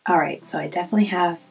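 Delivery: soft just-woke-up voice; sleepy morning voice, fresh out of bed. Real, not acted. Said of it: "a really soft morning voice".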